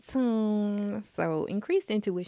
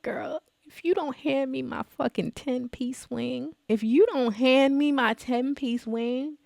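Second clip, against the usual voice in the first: mock threat; 8 semitones higher, 4 dB louder than usual. Retold demand to deli worker.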